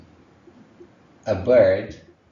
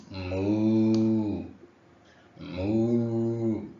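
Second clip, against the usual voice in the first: cow moo imitation. Shown as a demonstration. Teacher imitating a cow for students, mooing. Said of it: "Which animal makes that sound?".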